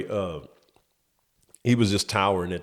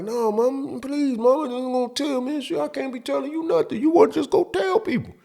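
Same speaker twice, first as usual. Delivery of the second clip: desperate begging. Retold or imitated. Kid begging mom.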